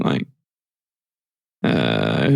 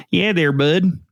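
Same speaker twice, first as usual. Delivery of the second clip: put-on Canadian accent; 5 semitones higher, slower than usual. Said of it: "talking like this there, bud".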